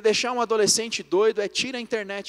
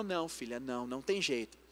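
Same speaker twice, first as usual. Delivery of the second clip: low, soft, understated firmness; firm refusal; 7 semitones lower, 11 dB quieter than usual. Retold or imitated. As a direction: imitate a mother quietly holding her ground. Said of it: "Ela manteve firme e falou".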